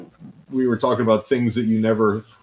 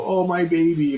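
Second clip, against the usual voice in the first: high-pitched doting cry of concern; hammed-up smothering parent, pitched high; fussing parent; 8 semitones higher than usual. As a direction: coo in mock alarm over a child. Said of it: "go scoop 'em up and go".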